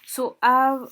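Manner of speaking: usual speaking voice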